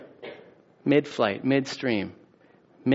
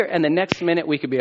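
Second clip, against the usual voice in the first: ordinary delivery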